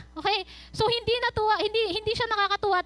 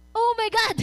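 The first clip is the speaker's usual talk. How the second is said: higher, louder mock shock; mock gasp; 4 semitones higher, 4 dB louder than usual. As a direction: fake the king's horrified exclamation. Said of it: "wala yun dun ah". (kunwaring gulat na sigaw)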